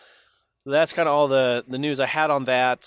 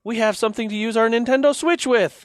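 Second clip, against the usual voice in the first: mock cheer, high and louder; imitating excited people; 8 semitones higher, 4 dB louder than usual. Host voicing fans reacting to party chat, mock excited. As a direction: gush like a thrilled crowd, hammed-up. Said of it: "a lot of people were like".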